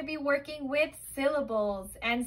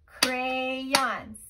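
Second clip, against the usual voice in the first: "Crayons" stretched into two even beats; syllable by syllable; 3 dB louder than usual. Shown as a demonstration. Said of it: "Two sounds in crayons".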